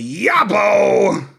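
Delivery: loud, high whoop; big drawn-out comic cheer; shouted salute. Retold or imitated. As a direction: holler "Yabo" long and high. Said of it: "I wanted to salute her in my own way".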